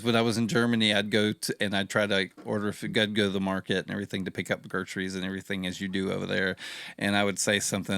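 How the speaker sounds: ordinary talk, nothing marked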